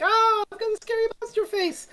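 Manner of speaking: very high, silly monster voice; mock villain wail. Borad answering Peri's scream, silly high taunt. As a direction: squeal it as a hammed-up monster. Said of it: "and the bar is like".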